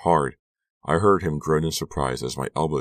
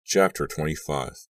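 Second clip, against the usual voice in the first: usual speaking voice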